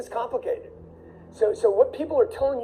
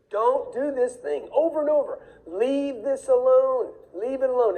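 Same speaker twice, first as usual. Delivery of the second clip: slow, higher, drawn-out warning; stern warning; 6 semitones higher than usual. Retold or imitated. To everybody buying crypto, a slow pleading warning. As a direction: plead with them to stay away, slowly.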